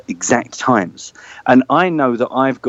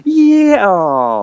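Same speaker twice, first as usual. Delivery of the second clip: cheer turning to letdown; 8 semitones higher, 3 dB louder than usual. Retold or imitated. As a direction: start as a roaring crowd, then deflate. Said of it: "heard a cheer turn into a, "Oh," so quickly".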